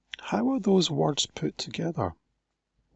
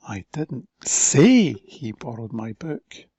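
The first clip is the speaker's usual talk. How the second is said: slow, louder with "say" stressed; 3 semitones lower, 7 dB louder, slower than usual. Shown as a demonstration. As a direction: lean on "say". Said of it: "different emphasis on different words".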